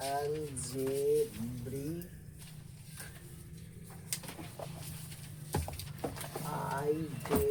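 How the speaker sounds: syllable by syllable, long drawn out; very slow, broken-up dictation. Shown as a demonstration. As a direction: stretch algebraic and identities into slow separate syllables. Teacher dictates term piece by piece.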